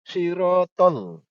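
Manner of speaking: Sirotol slow, with a heavy emphatic s; correct pronunciation. Shown as a demonstration. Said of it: "Kalau ini lidahnya di bawah gigi".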